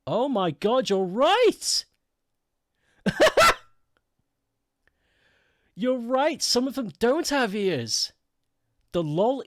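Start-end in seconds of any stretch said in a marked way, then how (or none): 0.1-1.9 high, rising amazed exclamation; astonished realization. Real, not acted. Streamer realizing viewer spotted missing ears, astonished exclamation. 3.0-3.6 short, loud, high cackle; real burst of laughter. Real, not acted. Streamer amused by missing emote ears, loud laughter. 5.8-8.1 high, drawn-out disbelief; amazed realization. Real, not acted. Streamer agreeing with viewer about ears, amazed realization.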